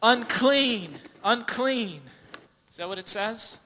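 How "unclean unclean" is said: raised, higher call of unclean; loud cry. Retold or imitated. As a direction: cry it out loud like a leper warning others. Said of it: "called out in a loud voice".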